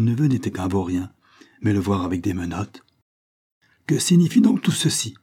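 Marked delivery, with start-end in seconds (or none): 3.9-5.1 high-pitched, commanding question; authoritative demand. A narrator's reading. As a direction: demand an explanation as the one in charge. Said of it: "d'un air d'autorité". (ton d'autorité indigné)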